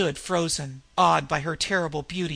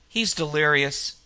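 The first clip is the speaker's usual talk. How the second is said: usual speaking voice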